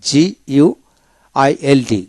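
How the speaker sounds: very slow, letter by letter spelling; deliberate slow spelling. Shown as a demonstration. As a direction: spell each letter slowly for the class. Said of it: "তার মানে কি জিআইএলডি".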